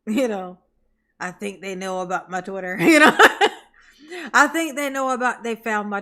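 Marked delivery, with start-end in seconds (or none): 1.2-6.0 laughing. Retold or imitated. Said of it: "he's like".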